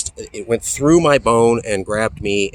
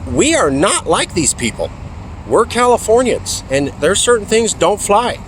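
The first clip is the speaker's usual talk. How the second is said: firm mother; 9 semitones higher than usual. Retold or imitated. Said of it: "she said".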